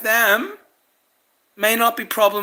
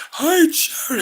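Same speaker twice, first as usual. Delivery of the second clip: high-pitched mock receptionist hello; imitated phone greeting; 8 semitones higher than usual. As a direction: mimic her greeting in a raised voice. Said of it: "this bitch is just like".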